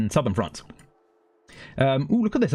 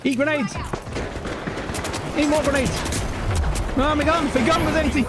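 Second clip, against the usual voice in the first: excited gaming yells; 13 semitones higher than usual. Real, not acted. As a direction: whoop at the enemies mid-fight. Shouting during game firefight.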